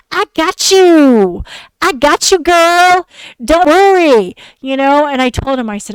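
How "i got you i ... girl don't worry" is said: high, loud mocking dude voice; mocking boyfriend. Mocking her boyfriend promising to cover her bills, loud and high. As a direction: ham up his empty promise, drawn out. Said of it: "I hate when guys say this".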